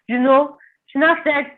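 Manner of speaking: usual speaking voice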